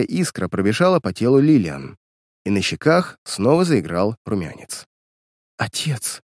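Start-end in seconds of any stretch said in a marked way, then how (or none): 5.6-6.2 soft breathless whisper. Acted. Daughter recognising her father, whispered. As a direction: breathe the word out in wonder. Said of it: "прошептала девушка".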